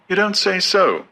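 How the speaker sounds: high, light polite murmur; polite Lenina. A narrator's reading. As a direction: humour him sweetly, voice up high. Lenina's line again.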